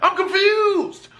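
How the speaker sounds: high, bewildered stammering; hammed-up baffled panic; panicked confusion. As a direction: act lost and alarmed. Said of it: "I'm like".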